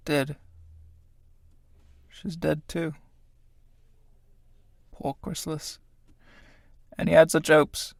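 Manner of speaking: soft, spaced-out musing; hushed mourning. Acted. Tramp kneeling by dead Chrysalis, hushed pity. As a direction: murmur it softly over the dead.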